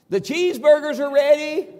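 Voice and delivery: high, full-voiced holler across the yard; yelled announcement. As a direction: yell out that the food is up. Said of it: "I yell out".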